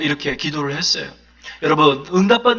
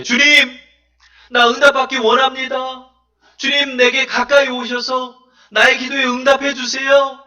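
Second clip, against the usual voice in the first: fervent prayer; 6 semitones higher than usual. Acted.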